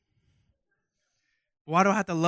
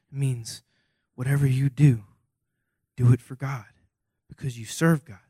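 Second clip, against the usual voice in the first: ordinary delivery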